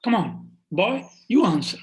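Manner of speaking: bluffing command. Acted. Priest calling the cowherd boy forward before the king, commanding. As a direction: summon him with showy confidence.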